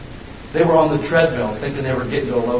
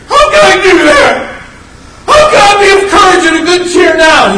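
much higher, loud, shouted disbelieving question; Peter's panicked question; 11 dB louder than usual. Retold or imitated. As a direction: shout it like Peter, afraid in the storm. Peter's frightened question voiced.

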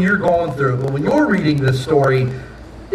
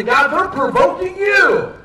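high, drawn-out cry to God; 12 semitones higher, slower than usual. Acted. Judah cries out to God over provocation, crying out. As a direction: plead upward in a much higher voice. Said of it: "they cry out to God".

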